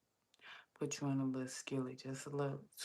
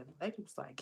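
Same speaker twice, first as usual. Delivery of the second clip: ordinary talk, nothing marked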